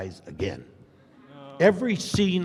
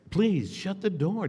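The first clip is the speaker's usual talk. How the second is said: pleading request; 8 semitones higher than usual. Retold or imitated. Retold request to co-star.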